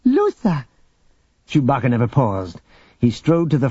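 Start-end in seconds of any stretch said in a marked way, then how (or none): 0.0-0.6 high, happy cry of a name; delighted cheer. Acted. Jaina cheers Lusa on, high and delighted. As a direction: cheer for her friend. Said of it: "Jaina laughed with delight".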